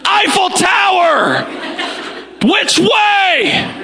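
high-pitched, drawn-out shout; exasperated tourist yelling at a foreigner. Acted. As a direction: shout it slowly as if volume will translate. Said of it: "We tend to get louder".

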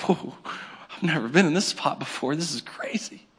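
hushed, low amazement; stunned aside. Acted. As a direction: drop to a soft, stunned undertone. Nehemiah stunned by the king's question.

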